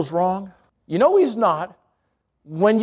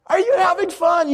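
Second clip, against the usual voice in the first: mock yell; 8 semitones higher than usual. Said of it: "they're yelling at you like".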